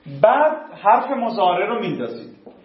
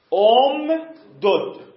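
ام دد punched louder and higher; emphatic repeat; 5 semitones higher, 5 dB louder, much slower than usual. Shown as a demonstration. Teacher repeating the answer for the class, louder and emphatic. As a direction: hammer home ام دد.

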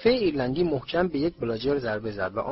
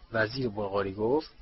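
ordinary delivery

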